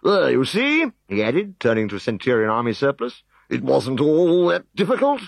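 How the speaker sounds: high, loud smug prefect; pompous official gloating. Acted.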